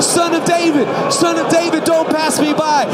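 high, loud plea over a crowd; urgent, full-volume beggar's cry; calling out. Acted. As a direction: shout to catch his attention.

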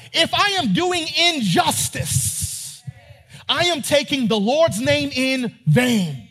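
climbing preacher's fervor; preaching at full force. Real, not acted. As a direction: drive it home like a pulpit preacher.

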